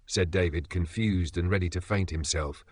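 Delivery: usual speaking voice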